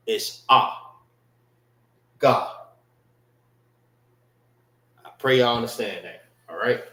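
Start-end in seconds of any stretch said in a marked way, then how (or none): 0.1-2.6 "ah" open and "God" with a round open o; Hebrew ah. Shown as a demonstration. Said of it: "When we pronounce our Hebrew".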